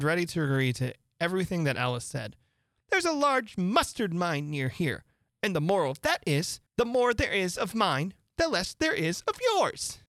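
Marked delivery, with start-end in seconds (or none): 2.9-10.0 lightly raised, preachy duchess; moralizing old noblewoman, sing-song; fussy Duchess voice. A narrator's reading. Duchess voiced in read story.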